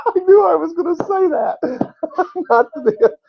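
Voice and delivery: helpless high-pitched laughter through the words; laughing. Real, not acted. Preacher cracking up at own slip, laughing. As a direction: crack up and try to carry on.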